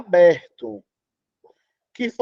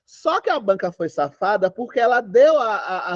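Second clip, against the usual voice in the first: ordinary delivery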